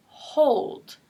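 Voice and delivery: "hold" slow, with a drawn-out O; long O. Shown as a demonstration. Teacher giving another example, slow "hold" with long O. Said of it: "they're going to say the long O sound".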